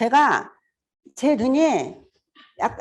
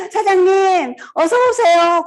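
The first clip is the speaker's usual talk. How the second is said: high, louder cheerful call; eager welcome; 9 semitones higher, 7 dB louder, slower than usual. Retold or imitated. 사장님을 반기며 높고 크게 외치는 인사. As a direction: welcome the boss with open arms. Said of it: "막 가고 싶은 거야".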